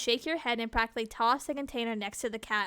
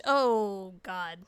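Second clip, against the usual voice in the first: usual speaking voice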